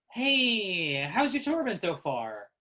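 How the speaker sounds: high, loud, drawn-out greeting; friendly guide character. Acted. Kimberly Duncan greets the tour group, loud high singsong. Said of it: "Who says".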